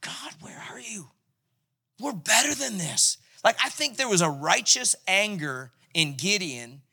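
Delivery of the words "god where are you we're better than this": hushed, breathy plea to God; whispered frustration. Pretend. Gideon at the winepress, a hushed frustrated whisper. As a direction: whisper it through the work. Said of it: "I do think he was like".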